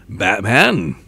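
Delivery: loud villain voice with a swooping pitch; Joker. Shown as a demonstration. Villain greeting the hero, mock theatrical. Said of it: "I can't do a Joker".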